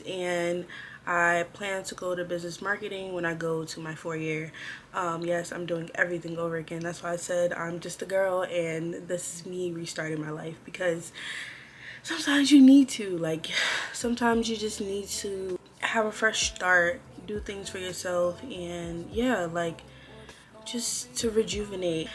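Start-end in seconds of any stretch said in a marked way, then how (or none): none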